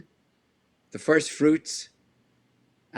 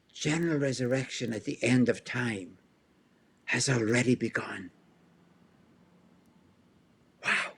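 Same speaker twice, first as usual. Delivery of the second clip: voicing Paul's excited wonder; 3 semitones higher than usual. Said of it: "now I'm going to quote Paul".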